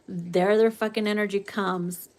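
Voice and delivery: usual speaking voice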